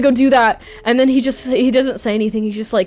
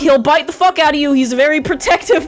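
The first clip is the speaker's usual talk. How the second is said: higher and louder, breaking into laughter; nervous laughing brag; 10 semitones higher, 6 dB louder than usual. Retold or imitated. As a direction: fake tough talk, laughing through it. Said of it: "So I just say".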